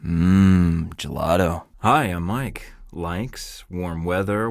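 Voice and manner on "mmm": pleased mmm. Acted. Savouring gelato.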